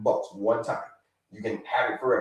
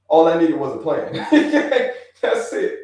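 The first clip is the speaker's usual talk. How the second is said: laughing; 4 dB louder than usual. Real, not acted. Quoting a viewer, laughing.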